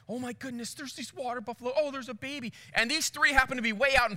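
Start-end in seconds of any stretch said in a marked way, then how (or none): 0.1-2.5 hushed, higher-pitched amazed tourist; quiet, high mock wonder; imitated tourists. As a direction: softly marvel like a sightseer spotting wildlife. Imitating excited tourists on safari.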